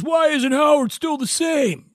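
imitating a grumbling listener; whiny fan voice. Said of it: "one of these idiots that goes".

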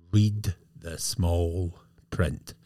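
slow, each word deliberate; slow emphatic advice. Real, not acted. Host hammering home his warning to riders slowly. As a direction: drive the point home slowly. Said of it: "said it before, say it again".

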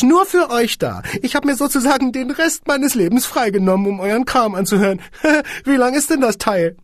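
very high, loud squeaky voice with giggles; squeaky little man. Acted. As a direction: squeak grandly, giggling midway. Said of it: "piepste das Männchen".

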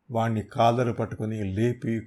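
plain reading voice

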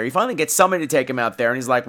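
normal talking voice

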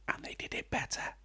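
very soft, whispered dig; whispered aside. Pretend. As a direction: whisper it like a secret. Cheeky aside, repeating earlier dig.

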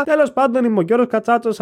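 normal talking voice

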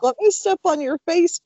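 high-pitched imagined plea from Toby; pet's pleading voice. Pretend. Host voicing Toby interrupting the podcast, high playful plea. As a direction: lift it high as a cheeky little request. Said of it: "Toby's like".

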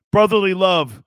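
punchy loud greeting; loud Philly shout-out. Real, not acted. Caller hailing the Philly hosts, loud and proud. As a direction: belt it like a proud fan.

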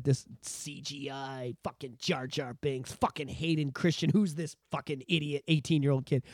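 put-on grumbling old fan. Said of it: "saw the prequels and went".

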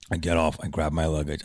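normal talking voice

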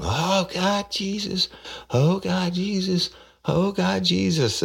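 high falsetto wailing suspect; mock screaming woman. Detective mimics the suspect screaming at her arrest, falsetto wail. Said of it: "she starts screaming".

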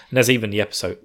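usual speaking voice

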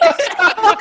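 high, loud burst of laughter; big laugh. Real, not acted. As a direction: crack up loudly. Cracks up after his story.

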